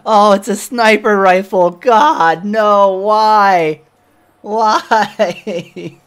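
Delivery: loud, high exasperated outcry; frustrated shout. Real, not acted. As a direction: yell at the bad loot. Frustrated loot reaction, shouting.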